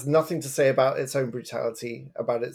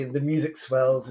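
ordinary talk, nothing marked

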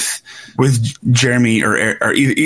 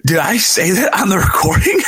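higher, startled "Did I say that"; alarmed self-question; 6 semitones higher than usual. Retold or imitated. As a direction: voice your own surprised panic. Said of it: "moments where I realized".

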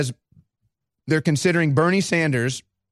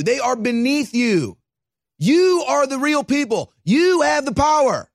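rallying; 10 semitones higher, 4 dB louder than usual. Real, not acted. Rallying the audience.

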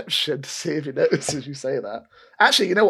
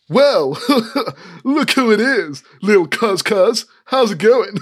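high, loud smug sneer; smug cousin; 7 semitones higher, 6 dB louder than usual. Acted. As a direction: gloat over your cousin. GM voices smug cousin Elfgeet.

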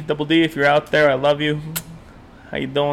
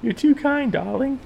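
mock posh gratitude; 5 semitones higher than usual. Playacting a thankful lady.

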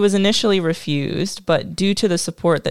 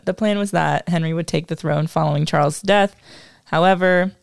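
ordinary talk

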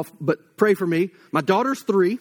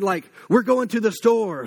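ordinary talk

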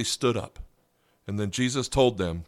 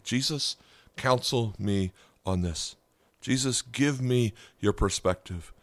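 slow, measured, lower-pitched prayer; model prayer; 3 semitones lower, 3 dB quieter than usual. Shown as a demonstration. Showing listeners what to ask Jesus through the day, prayerful. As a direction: pray it slowly, pausing after each plea.

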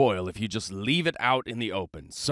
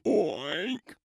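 pig oink; 4 semitones higher than usual. Acted. Pig voice after quote.

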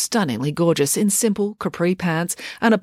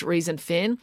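ordinary delivery, nothing marked

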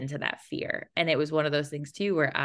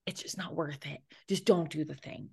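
1 mock inner critic murmur; 7 dB quieter than usual. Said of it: "that voice that says".

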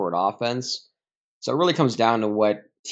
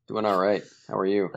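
ordinary delivery

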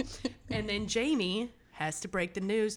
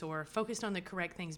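ordinary talk, nothing marked

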